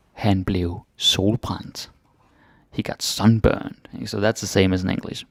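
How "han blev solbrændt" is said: very slow, each word drawn out; slow for learners. Shown as a demonstration. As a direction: spell it out slowly for the learner.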